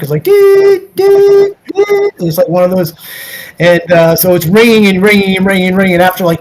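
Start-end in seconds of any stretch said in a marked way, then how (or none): 0.2-2.1 high, even electronic ring tone; imitated phone ring. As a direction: mimic a foreign dial phone ringing. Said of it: "it's not like the ring you have in America".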